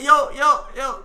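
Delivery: calling out. Retold or imitated. Calling out loudly.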